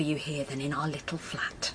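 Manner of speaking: hushed whisper so no one hears. Acted. Wife soothing her husband at bedtime, in a whisper. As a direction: keep it secret, whisper it.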